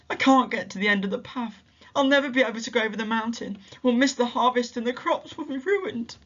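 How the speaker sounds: high, hurried, near tears; tearful despair. A narrator's reading. Injured mother to Sammy, tearful rush of despair. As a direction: pour out the hopelessness quickly. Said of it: "she fell to the ground in tears".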